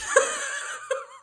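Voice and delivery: shrill burst of disbelieving laughter; high incredulous laugh. Real, not acted. Mocking the mermaid critics with a high incredulous laugh.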